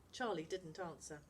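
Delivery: ordinary delivery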